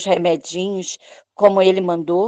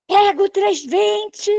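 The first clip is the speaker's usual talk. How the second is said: high-pitched, slow mock shout of the doctor; doctor shouting across the cath lab, high and urgent; retold shout; 11 semitones higher, slower than usual. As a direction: call it out high and urgent, stretching the words. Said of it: "aí ele gritou".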